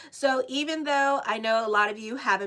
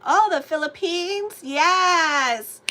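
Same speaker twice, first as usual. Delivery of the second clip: excited; 6 semitones higher, 7 dB louder, much slower than usual. Real, not acted. Greeting viewers' country.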